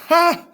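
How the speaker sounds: sharp, very high and loud mocking "Ha!". A narrator's reading. Achilles jeering at the archers, a loud high mocking ha. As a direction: bark a scornful laugh at them. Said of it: "Achilles mocked the Trojans".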